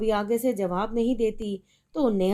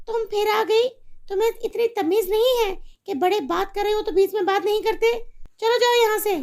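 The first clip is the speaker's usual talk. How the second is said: much higher, louder, fast scolding; furious mother; 11 semitones higher, 4 dB louder, faster than usual. Acted. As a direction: chase the daughter away. Mother yells at daughter.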